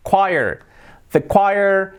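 choir modelled as KWAI-er; pronunciación correcta. Shown as a demonstration. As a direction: say "choir" with a kw start. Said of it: "completamente diferente". (choir como 'kuáier')

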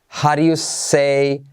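"How do you say" slowly as "haɾju sei"; drawn-out "howdya say"; slow contracted demo. Shown as a demonstration. Teacher walks students through the phonetics slowly. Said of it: "Te pongo ahí la transcripción".

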